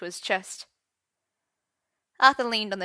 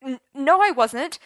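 loud, high panicked stammer; stuttered; 6 semitones higher, 10 dB louder than usual. A narrator's reading. Merlin denying it in a cold sweat, loud stutter. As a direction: trip over the denial in panic. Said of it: "Merlin stuttered".

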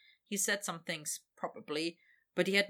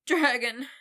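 higher, louder rising plea; senseless begging; 6 semitones higher, 10 dB louder than usual. Acted. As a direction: call the name, past reason. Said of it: "Victor begged senselessly".

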